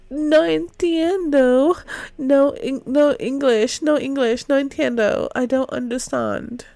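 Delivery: Spanish accent, pretending not to understand English; fake Spanish accent. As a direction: play dumb in a broad Spanish accent. Character plays dumb to roommates.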